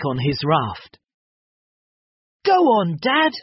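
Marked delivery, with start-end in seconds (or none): 2.4-3.3 high, loud cheer for Dad; shouted. A narrator's reading. Wilma cheering Dad back on the raft, shouting. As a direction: call out to get him moving. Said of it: "shouted Wilma".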